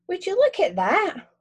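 high and slow, full of wonder; amazed exclaim. Acted. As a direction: gasp it out in awe. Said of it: "she exclaims".

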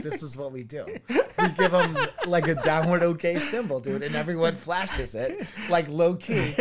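loud, high excited telling. Retold or imitated. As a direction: hype it up loudly. Excitedly retelling his joke.

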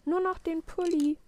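put-on high, eager child's reply; little boy calling back. A narrator's reading. Willi still getting dressed answers Papa, calling back.

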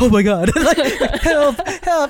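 high, laughing cry for help; hammed-up frightened squeal; mock panic. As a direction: imitate a panicked victim through laughter. Voices fleeing girl looking back, laughing.

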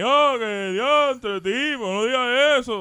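loud, high, drawn-out wail of protest; mock protester. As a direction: mimic a caller complaining, high and stretched. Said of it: "rápido empieza a llamar por ahí".

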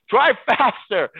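high, loud, drawn-out command; impatient order. Retold or imitated. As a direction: holler it at the driver from behind. Retold backseat order.

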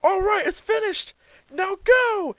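high, strained hero voice; Goku voice. Retold or imitated. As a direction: call it out high like a young hero. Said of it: "Goku says".

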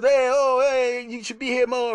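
big, high, booming hey-oh welcome; loud owner greeting. Retold or imitated. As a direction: greet a favorite customer at full volume. Owner greets regular customer loudly.

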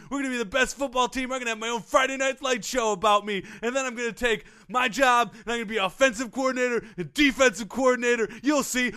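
high, ranting mock defiance; untethered Romeo. Fired coach vowing a comeback to his boss, high and unhinged. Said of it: "He went off the tracks".

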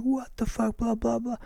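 high-pitched, restrained mock yell; mock screaming. Other driver ranting at him after the crash, mock screaming. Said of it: "starts screaming at me".

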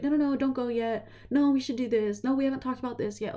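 high, urgent mock pleading, quick at the end; pleading host. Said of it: "and he was like".

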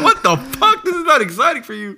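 laughing impression of an offended friend; mock outrage. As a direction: play the offended friend while cracking up. S1 mocks Rick's outrage.

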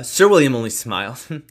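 usual speaking voice

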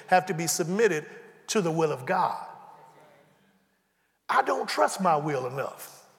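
ordinary delivery

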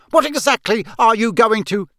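high, loud blustering demand; bellow. A narrator's reading. Uncle demands answer from fire, bellowing. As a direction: bluster loudly until cut off. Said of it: "he bellowed at the fire".

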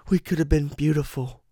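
high, loud, put-on creepy croon to a victim; creepy lover voice. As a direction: coo it like a deranged romantic. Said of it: "and be like".

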